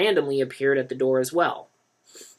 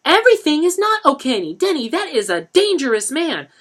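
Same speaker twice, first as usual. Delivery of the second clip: much higher, louder stern woman; scolding mother; 10 dB louder than usual. A narrator's reading. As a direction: warn him fiercely.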